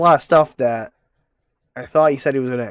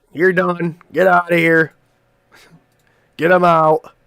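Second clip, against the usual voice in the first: mock dismissal; 5 semitones higher, 5 dB louder, slower than usual. Real, not acted. Dismissing the gun guy video.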